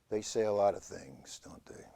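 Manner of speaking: whisper. Acted. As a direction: drop to a whisper. Whispered aside.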